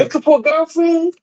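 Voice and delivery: high, pushy mock flirting voice; mimicking flirty woman. Caller imitates women flirting with him abroad, high mock voice. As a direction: play the woman asking if he's single. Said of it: "I was like".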